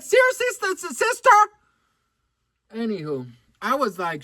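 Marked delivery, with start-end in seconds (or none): 0.0-1.3 very high, stammering mock outrage; shocked disbelief. Customer stunned by a rude saleswoman, high shocked squeal. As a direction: squeal it, scandalized. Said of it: "shocked, girl".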